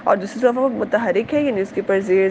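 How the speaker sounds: ordinary delivery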